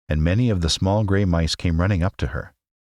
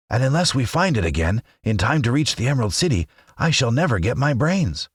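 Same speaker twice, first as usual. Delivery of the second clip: higher, mildly grumbling scarecrow; 7 semitones higher than usual. A narrator's reading. Scarecrow complaining to his companions, mild grumble. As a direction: grumble it, lost and fed up. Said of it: "The Scarecrow began to grumble".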